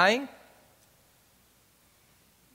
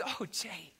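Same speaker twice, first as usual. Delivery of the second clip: very soft, gentle retold counsel; quiet reassurance; 15 dB quieter than usual. Friend quietly tells father about the mystics, quiet reassurance. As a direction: hush it right down, like sharing a secret.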